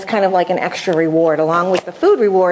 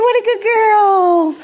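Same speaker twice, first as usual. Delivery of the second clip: high, slow, gushing praise; celebrating praise; 12 semitones higher, 3 dB louder, slower than usual. Shown as a demonstration. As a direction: gush over her slowly, way up high. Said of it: "and celebrate".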